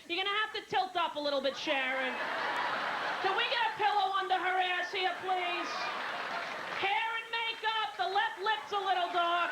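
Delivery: hammed-up crew guy yelling directions at the set; bossy crew member. Film crew barking orders on set.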